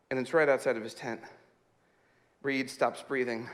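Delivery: low, hushed, tense narration; quiet campfire-thriller hush; suspenseful storytelling. Retold or imitated. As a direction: drop it down for suspense. Suspense telling of night in tent.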